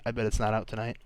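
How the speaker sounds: usual speaking voice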